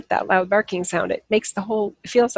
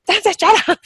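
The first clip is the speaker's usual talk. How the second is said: high, louder mock yell at the dog; imitated irritated yell; 12 semitones higher, 6 dB louder than usual. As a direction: re-enact snapping at a barking dog, half laughing afterwards. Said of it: "I started yelling at him".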